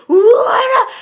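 very high, loud startled yell; retold clown outburst; mock clown shout. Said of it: "He's like".

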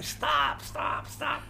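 a hint of a raspy electrolarynx voice; throat gadget voice. Owner begging the band to stop, lightly mimicked voice. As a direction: mimic a man talking through a throat device. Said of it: "the guy's running around saying".

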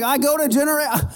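high clipped start; mimicking the visitor. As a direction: cut yourself off mid-word. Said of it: "You're like".

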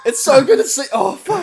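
high, loud delighted squeal; laughing squeal. Real, not acted. Laughing delight at imagined scene.

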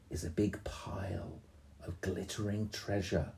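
hushed whisper of wonder. Acted. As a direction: drop to a secret whisper. Whispered treasure reveal.

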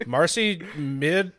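normal talking voice

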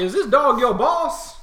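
high, loud, slow question; incredulous. Acted. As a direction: ask it in amused disbelief. Shopkeeper amused question.

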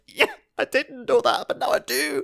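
very high, squeaky-excited comic voice; mock thrilled reply. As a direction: answer like an over-delighted player. Acts out reply to game tips.

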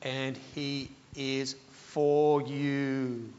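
slow, soft reassurance; tender reassurance. Real, not acted. Preacher reassuring the congregation of God's love, soft and slow. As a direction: let it land gently and slowly.

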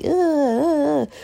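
put-on high disgusted groans of a picky kid; kids' disgusted ugh. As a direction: mimic kids refusing the food. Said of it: "my kids are like".

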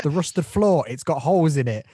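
loud, high sidekick explanation; explaining Robin. Retold or imitated. Robin explaining the joke to Batman, mock insistence. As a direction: spell it out loudly like an eager sidekick. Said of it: "And he goes".

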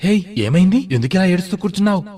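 high-pitched, fast anxious questioning; father startled by his child's tears; concerned surprise. A narrator's reading. Father finds his daughter crying.

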